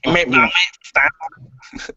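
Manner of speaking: put-on a slow, lightly done Scouse accent; bad Liverpool accent, dragged out slowly; poor Scouse accent. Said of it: "a poor Scouse accent".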